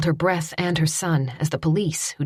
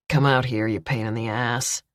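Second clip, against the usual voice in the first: lower, menacing villain voice; gruff it out like a thug; rough male villain; 5 semitones lower than usual. A narrator's reading. Billy orders the boy out.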